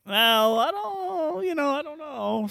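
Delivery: high, unsure mock voice; high mock whine. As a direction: squeak it out sheepishly. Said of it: "And I was like".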